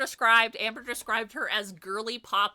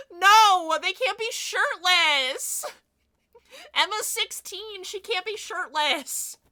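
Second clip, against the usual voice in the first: mock-scandalized protest; 13 semitones higher, 9 dB louder, slower than usual. Real, not acted. Scolding her own silly idea.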